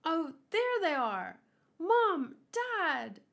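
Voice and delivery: high happy calling out; gleeful shout to mom and dad; excited call to parents. Acted. Gabi spots her parents in the crowd, calls out gleefully.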